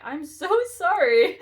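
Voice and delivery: embarrassed laugh breaking into the apology; giggling out an apology over a miscounted card. Real, not acted. Laughs at own card mistake.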